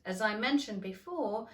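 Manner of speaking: plain reading voice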